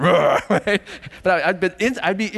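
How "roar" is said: short, loud pretend growl; mock angry roar. Father joking about showing anger outside, mock roar. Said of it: "Not like I'd show it on the outside".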